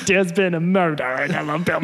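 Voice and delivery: put-on near-right Creole Alabama accent; best Creole accent try; close Creole accent. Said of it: "That's pretty close".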